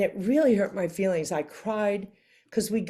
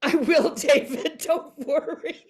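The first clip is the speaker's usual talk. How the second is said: high and laughing, brushing off his worry; laughing reassurance; 10 semitones higher, 3 dB louder than usual. Real, not acted. Actress laughing as she reassures the nervous supervisor offstage. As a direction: laugh it off while reassuring him.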